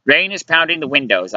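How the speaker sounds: ordinary delivery, nothing marked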